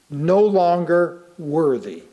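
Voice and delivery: ordinary talk, nothing marked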